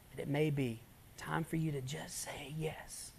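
slow hushed sermon whisper; intimate whisper preaching. Acted. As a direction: draw the listeners in with a whisper. Whispered altar call.

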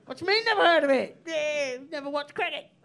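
fast, high, loud mock-indignant retort; mock outrage. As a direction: play at being offended, quick.

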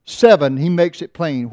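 ordinary talk, nothing marked

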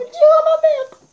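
put-on shrill loud whining child; whiny kid. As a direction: whine it like a tattling kid. Élève qui pleurniche. (gamin qui pleurniche fort)

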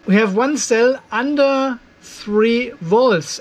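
ordinary talk

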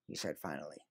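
ordinary delivery

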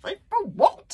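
exasperated, high and loud outburst; fed up, snapping back at Hiccup. A narrator's reading. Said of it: "said Fishlegs in exasperation".

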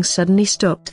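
ordinary delivery

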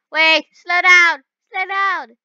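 loud, high urgent cries; alarmed shouting. Real, not acted. Driver to the speeding car, alarmed shouting. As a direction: yell at a runaway car. Car going too fast.

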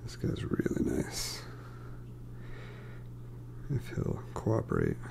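whispered aside, barely voiced; whispering. Real, not acted. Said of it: "I'm whispering 'cause the kids are asleep".

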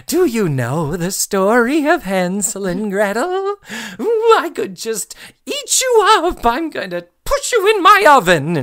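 high, gleeful witchy cackle-voice; creepy witch voice. Retold or imitated. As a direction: tease the kids like a hungry fairy-tale witch. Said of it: "is like".

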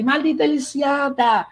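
high-pitched, hammed-up soap-opera cursing; mock outrage, each insult thrown out high; Soraya's insults. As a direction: imitate a telenovela villainess berating a rival. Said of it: "E chama ela de".

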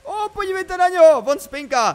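high, loud squeal easing down at the end; delighted gushing. Real, not acted. Player showing viewers the sleeping creature, delighted. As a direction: gush over something adorable in a high voice.

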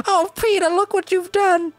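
high, crying mock wail; mock lament. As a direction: wail it like a heartbroken parent. Mock scolding a game character.

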